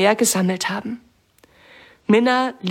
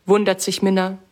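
usual speaking voice

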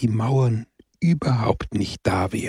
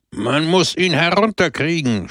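höher, empört gerufen; über das Glockengeläut hinwegschreien; empörter Schrei; 7 semitones higher than usual. A narrator's reading. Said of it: "schrie der Redner empört". (Redner ruft empört die Amtsträger zu Hilfe)

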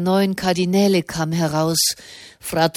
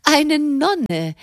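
much higher, amazed exclamation; astonished; 9 semitones higher than usual. A narrator's reading. Madame astonished the writer is a nun, high amazed exclamation. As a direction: gasp it out in disbelief. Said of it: "rief Madame erstaunt".

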